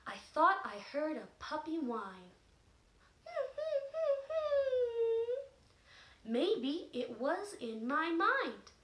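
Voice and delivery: slow, sing-song reading; poem reading. Shown as a demonstration. Teacher reading the puppy poem to children, slow sing-song. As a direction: read it slowly like a rhyme for kids. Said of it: "So I would say".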